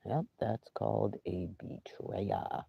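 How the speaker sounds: slow, unvoiced whisper; whispered slowly. Real, not acted. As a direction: breathe it out like a secret. Whispered aside after pause.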